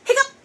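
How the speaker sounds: a sharp mock hiccup; hiccup sound. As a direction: pop out a little hiccup. Acting out Pete's hiccup.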